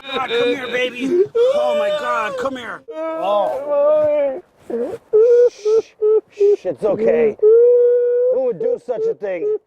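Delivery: put-on high falsetto cooing to a crying baby; shrill hammed-up baby soothing; bouncing a baby. Comforting baby in comic skit.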